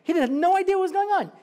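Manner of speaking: normal talking voice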